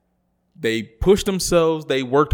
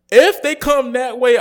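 loud outburst; 11 semitones higher, 6 dB louder, slower than usual. Real, not acted. Rant peak.

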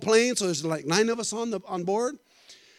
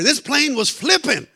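hammed-up dramatic; 2 semitones higher, 7 dB louder, slower than usual. Exaggerated storm story, building.

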